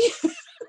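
short high-pitched laugh of disbelief. Real, not acted. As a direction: burst into a squeaky laugh. Laugh after the retold question.

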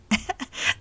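a quick breathy laugh at herself; short laugh. Real, not acted. Woman laughing at her childhood daydream, short laugh. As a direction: laugh it off, amused. Amused by own childhood memory.

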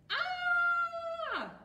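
very high, loud acted scream; piercing scream. A narrator's reading. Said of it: "screamed Billy".

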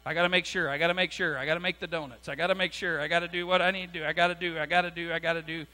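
low, soft, repetitive muttering; anxious self-reminding. Acted. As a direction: run through a worried checklist under your breath. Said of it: "you're always walking around going".

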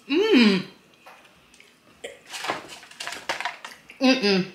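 talking around chewed food; muffled hums while chewing; mouth full, chewing. Real, not acted. Seen 0: Right-hand speaker bites, then chews open-mouthed with food visible throughout.

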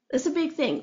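usual speaking voice

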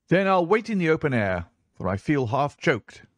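a hint of an impatient gentleman, high; lightly voiced impatient visitor; restless gentleman. A narrator's reading. Boy quoting impatient gentleman.

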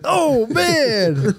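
high excited announcer cry; booming sports commentator reaction; John Madden commentator. Retold or imitated. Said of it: "a John Madden the whole time".

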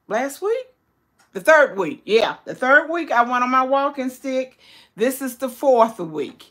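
ordinary delivery

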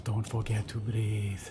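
slow French-accented imitation; heavy French accent. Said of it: "In a very heavy French accent".

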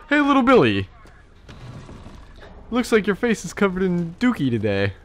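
loud, high-pitched teasing dad voice; bad-parent impression. Mock father talking to little Billy, teasing. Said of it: "that was a good impression".

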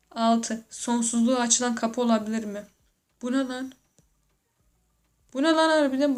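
ordinary delivery

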